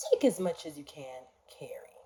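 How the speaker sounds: hushed, breathy seahorse whisper; a seahorse whispering its kind offer. Acted. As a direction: whisper it like a gentle sea creature. Seahorse answers little fish.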